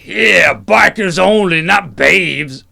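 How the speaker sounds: loud, slow biker jeer. Acted. Second biker jeering at newcomers, loud and slow. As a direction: back up your mate, drawn out.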